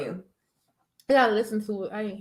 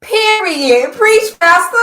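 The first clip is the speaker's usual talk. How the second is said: loud hype, pitched way up; hype shout; 10 semitones higher, 11 dB louder, much slower than usual. Real, not acted. Cheering the friend's preachy rant, loud hype. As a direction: cheer her on like church.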